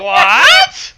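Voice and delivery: high, loud laughing shock; laughing shriek. Real, not acted. Friend reacting to the joke, laughing shriek.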